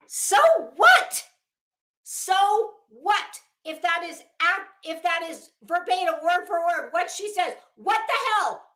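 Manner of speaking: high, loud disbelieving rant; so what, who cares, fired up; exasperated outburst. Real, not acted. Exasperated rant at lawsuit text.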